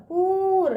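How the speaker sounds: पूर with a long held ū; drawn-out long ū. Shown as a demonstration. Teacher showing how it is read, पूर with stretched ū. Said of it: "ऐसे पढ़ेंगे पूर".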